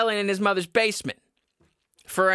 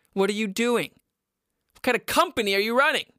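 indignant rant; 5 semitones higher, faster than usual. Real, not acted. Ranting at sauna company.

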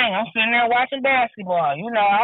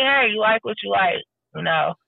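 ordinary talk

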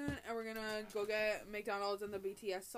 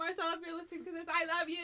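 laughing; 6 semitones higher than usual. Real, not acted. Laughing shout-out to dad.

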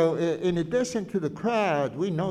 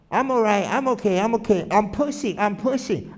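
higher, louder strained voice, a hint of a girl pushing; young girl in the crush; 3 semitones higher, 4 dB louder than usual. Quoting a girl pushing at the police front line, strained and raised. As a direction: gasp it out as if pushing against a crowd. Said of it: "you could hear her say".

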